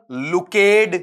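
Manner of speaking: लुकेड with a long "ay" before the "d"; wrong two-syllable reading. Shown as a demonstration. Teacher reading out a wrong option, लुकेड in two syllables. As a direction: say लुकेड slowly as "lu-kayd". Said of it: "देखो इसका उच्चारण क्या लिखा".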